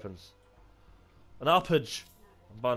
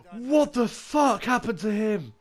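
high, drawn-out incredulous cry; astonished outburst, slow and high-pitched; real shocked disbelief; 8 semitones higher, slower than usual. Real, not acted. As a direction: stretch it out high, aghast. Shocked at a teammate's fate.

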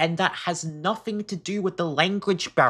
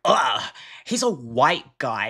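demoing the hateful way to say it; disgusted; 2 semitones lower than usual. Shown as a demonstration. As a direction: spit it out with disdain. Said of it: "there's a difference there".

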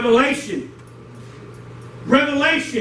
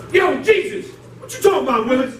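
Willis catchphrase; 3 semitones higher than usual. Acted. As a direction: challenge Jesus like a sitcom kid. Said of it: "some of y'all don't know about Willis".

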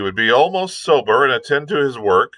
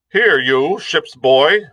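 put-on slow, higher, louder shout at the boy; cried out; 7 semitones higher, 5 dB louder, slower than usual. A narrator's reading. Captain shouts at the ship's boy, slow and louder. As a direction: bark it across the deck. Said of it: "he cried".

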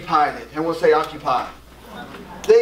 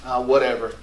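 a softer, lower, dismissive shrug; giving up; 7 semitones lower, 7 dB quieter than usual. Retold or imitated. Believer giving up on God, muttered dismissively. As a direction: toss it off like you're done caring. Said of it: "we just say".

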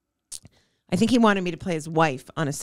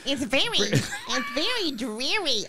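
drawn-out complaint; 7 semitones higher, 3 dB quieter, slower than usual. Real, not acted. Complains about the lighting.

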